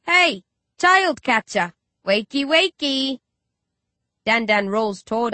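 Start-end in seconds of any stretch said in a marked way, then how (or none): 0.1-3.2 higher, louder, slow teasing call; shouting. A narrator's reading. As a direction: wake him with a mocking shout. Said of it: "shout at the sleeping creep".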